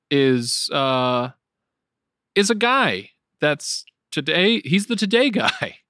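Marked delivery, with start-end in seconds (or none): none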